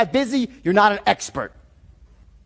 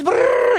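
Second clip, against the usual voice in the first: high trilled brrr mimicking fast chatter; rapid babble noise; 3 dB louder than usual. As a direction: rattle off a brrr like nonstop talk.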